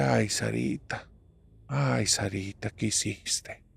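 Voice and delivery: soft, slow, drawn-out dismay; hushed motherly dismay; dismayed lament. Acted. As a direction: lament it quietly, stretching the words. Mother laments what daughter did.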